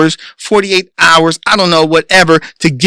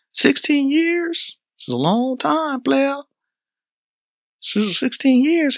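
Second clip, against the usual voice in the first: mock incredulity; 7 semitones higher, 9 dB quieter, slower than usual. Mocking parent's claim.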